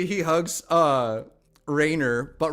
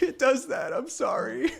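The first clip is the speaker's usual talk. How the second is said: high, tearful acted apology; weepy, high-pitched apology; in tears; 11 semitones higher than usual. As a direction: say it helplessly through mock tears. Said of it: "He's in tears".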